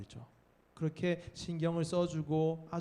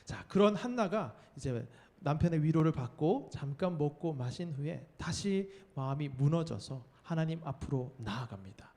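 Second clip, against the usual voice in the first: ordinary talk, nothing marked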